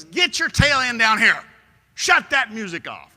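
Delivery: high, loud order to get downstairs; father shouting up at his daughter; angry dad yell. Retold or imitated. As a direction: bark the order, no arguing.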